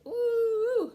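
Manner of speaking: high, loud pained ooh. A narrator's reading. Pack rat stumbling out, high loud ooh of pain. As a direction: cry out from the sting.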